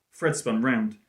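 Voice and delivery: ordinary delivery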